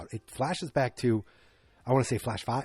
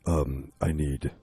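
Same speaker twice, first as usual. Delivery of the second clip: much lower, slow gruff action-star voice; Vin Diesel impression; 9 semitones lower, slower than usual. Star dictating contract demands, low slow mock voice. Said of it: "he's like".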